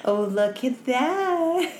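very slow, high, laughing; laughing through words. Retold or imitated. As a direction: crack up while saying it. Laughing retelling of awkward moment.

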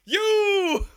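high, loud pointing "You!"; a sudden high "You!" of recognition; surprised recognition. Retold or imitated. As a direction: shout it like the Spider-Man meme. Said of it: "we went".

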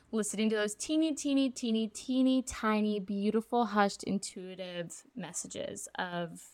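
tiny hushed voice. Real, not acted. Describing hushed intuitive messages.

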